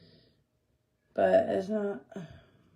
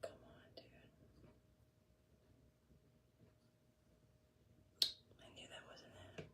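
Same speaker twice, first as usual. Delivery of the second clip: whispering; 25 dB quieter, faster than usual. Real, not acted. Whispering to himself while painting.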